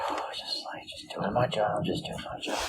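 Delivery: sigh, then a whispered mumble; tired whispered grumble; weary whisper. Acted. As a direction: mumble it under your breath, worn out. NPC sighs and mumbles wearily.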